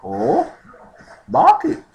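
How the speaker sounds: very high, loud surprised query; surprised question. Acted. Friend asks why in Tagalog, surprised.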